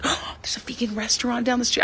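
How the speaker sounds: breathy, high, hushed delight; whispered wide-eyed glee; excited whisper. Retold or imitated. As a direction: gasp it out like a thrilled little kid. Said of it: "I was just excited, like a little kid".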